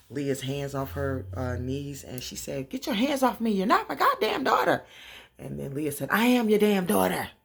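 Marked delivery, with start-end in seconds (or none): 2.7-4.8 very high, loud shrieky outburst; acted angry yelling. Mama Rose snatches Leah's hands off, high loud mock fury. 6.1-7.3 loud, raised-pitch retort; acted angry shout. Leah answers her mother's rejection, loud acted shout.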